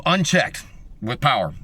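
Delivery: ordinary talk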